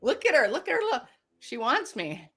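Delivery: playful teasing. Pretend. Teasing back.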